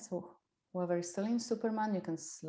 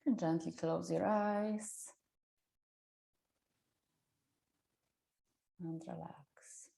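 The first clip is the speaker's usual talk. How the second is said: slow guided relaxation whisper; soft spa whisper; 3 dB quieter, slower than usual. Acted. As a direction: breathe the words out slowly, unvoiced. Leads relaxation, guided meditation.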